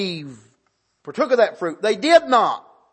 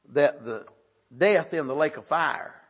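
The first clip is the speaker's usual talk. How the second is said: normal talking voice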